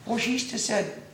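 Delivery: plain reading voice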